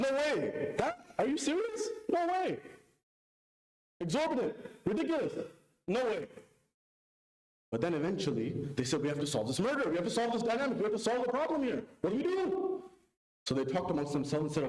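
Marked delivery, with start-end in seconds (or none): 0.0-6.3 high, indignant refusal; outraged disbelief. Retold or imitated. Buyers reject the price. 9.0-12.8 worried buyers. Retold or imitated. Buyers debating in panic.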